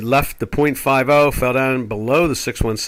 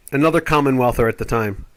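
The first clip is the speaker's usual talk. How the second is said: usual speaking voice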